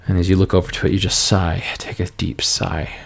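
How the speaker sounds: low, even scene-setting voice; game master narration. A narrator's reading. GM describing the room to the player, low and even.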